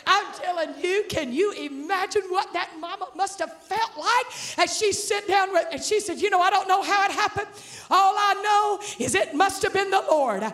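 high-pitched, driving sermon delivery; full-force preaching. Real, not acted. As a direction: preach the story with mounting excitement.

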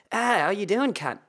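bright Australian mate voice; Australian greeting. Retold or imitated. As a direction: greet a friend like a cheerful Aussie. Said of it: "like Australians do".

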